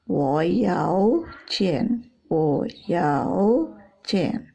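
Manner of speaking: very slow "Wo yao qian", "yao" drawn out; nada yao berbeda. Shown as a demonstration. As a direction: stretch the tone on "yao", pause before "qian". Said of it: "Tapi kalau sudah tandanya gini". ("yao" dipanjangkan dalam "Wo yao qian")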